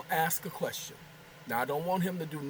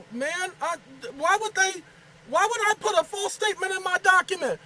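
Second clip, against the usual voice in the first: much higher, louder disbelief; indignant outrage; 13 semitones higher, 7 dB louder than usual. Real, not acted. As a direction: protest the accusation, offended. Outraged at the AI's claim.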